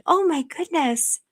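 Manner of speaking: normal talking voice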